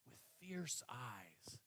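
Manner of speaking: whispered, slow and soft; preacher whispering the wolf's entrance, slow and hushed. A narrator's reading. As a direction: drop to a slow whisper for the wolf. Storyteller hushes for the wolf.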